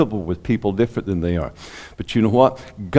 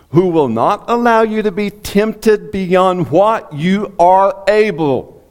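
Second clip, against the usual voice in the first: high, slow emphatic reading; preaching; 9 semitones higher, 4 dB louder, slower than usual. Acted. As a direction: press every word of the promise.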